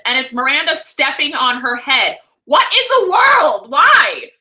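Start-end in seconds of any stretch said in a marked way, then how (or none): 2.5-4.3 shocked. Real, not acted. Shocked by a picture.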